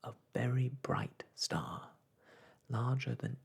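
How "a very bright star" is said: plain reading voice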